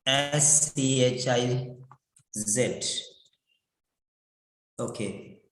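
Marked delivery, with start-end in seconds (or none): none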